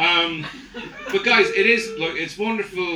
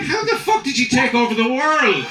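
loud disbelieving shout; exasperated mock yell; 7 semitones higher, 5 dB louder than usual. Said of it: "I was like".

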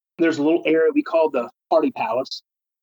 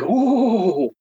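mock awed ooh; 7 semitones higher than usual. Group reacts to light stopping.

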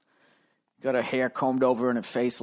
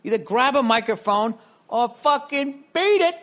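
loud; 10 semitones higher, 5 dB louder than usual. Real, not acted. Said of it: "You don't have to talk so loud".

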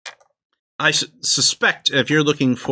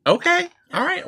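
squeaky high okay; high-pitched okay. Acted. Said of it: "He just said, "All right".

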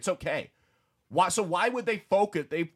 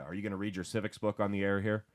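ordinary delivery

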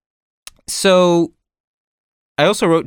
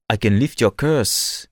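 put-on slow, low Caribbean-accented line; lightly voiced Caribbean accent, drawn out; 6 semitones lower, 4 dB quieter, slower than usual. Imitating the film villain's Caribbean accent to friends. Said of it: "he does sound like".

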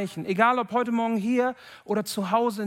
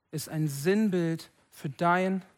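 normal talking voice

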